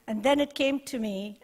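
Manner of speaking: ordinary talk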